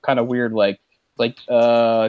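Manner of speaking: ordinary delivery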